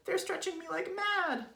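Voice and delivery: very high, loud squeals; Mrs. Twit's excited cry. A narrator's reading. Wife answering as balloons pull her, high cry. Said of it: "cried Missus Twit".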